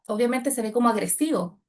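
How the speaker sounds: ordinary talk, nothing marked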